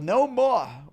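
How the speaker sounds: high, loud storybook yell. A narrator's reading. Farmer as the bird flees into the catacombs, yelling. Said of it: "The farmer yelled".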